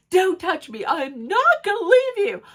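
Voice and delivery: high, heated back-and-forth; acted quarrel. Two women quarrel as one follows the other, high mock protest.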